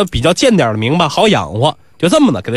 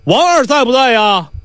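calling at the door; 8 semitones higher than usual. Acted. Nisi knocking at Wang Er's gate, calling out. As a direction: sing out for whoever is inside. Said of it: "一叩门，梆梆梆".

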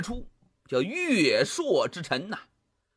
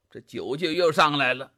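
ordinary delivery

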